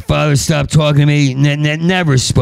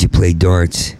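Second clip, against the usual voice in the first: usual speaking voice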